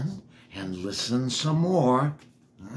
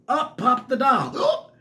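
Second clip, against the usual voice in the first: excited; 9 semitones higher, 6 dB louder than usual. A narrator's reading. Dramatic reading of pop.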